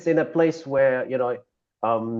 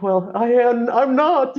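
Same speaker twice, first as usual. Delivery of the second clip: teary reply; 9 semitones higher, slower than usual. Retold or imitated. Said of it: "I was like".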